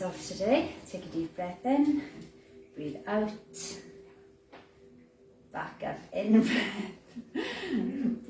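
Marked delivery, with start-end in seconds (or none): 6.4-8.3 breaks into a giggle mid-cue; real laugh. Real, not acted. Instructor cracks up while counting breaths. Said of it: "I'm laughing because".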